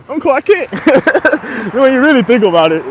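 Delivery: high, loud, laughing realization. Real, not acted. Speaker tickled at being Clark Kent himself, laughing.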